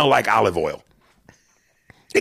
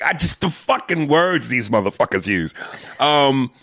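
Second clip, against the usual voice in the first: ordinary talk, nothing marked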